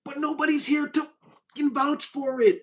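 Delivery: high, loud exasperated snap; cussing outburst. Retold or imitated. Telling off the one playing victim, a loud high outburst. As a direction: yell it at the liar, fed up. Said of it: "I'm gonna curse a little bit".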